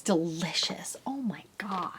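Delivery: hushed, savoring whisper. Real, not acted. Recalling her old onion dinner in a hushed whisper. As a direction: breathe it out like a guilty pleasure. Reminiscing about favorite dinner.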